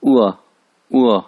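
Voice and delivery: उआ punched out from the throat; forceful falling उआ. Shown as a demonstration. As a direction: make उआ a falling, throaty ain sound. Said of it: "हलक से निकाल लेंगे".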